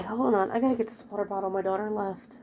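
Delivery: ordinary delivery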